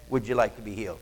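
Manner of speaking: soft, low, gentle question; gentle invitation. Acted. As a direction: offer it quietly like a kind healer. Jesus quoted gently at pool.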